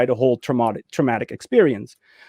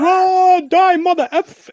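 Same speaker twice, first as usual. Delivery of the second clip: much higher, louder mock battle cry; hammed-up action hero yell; 5 dB louder, slower than usual. Mocking US films, a hero jumping at zombies with grenades, hammed-up yell. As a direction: play a reckless American hero charging zombies. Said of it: "be like".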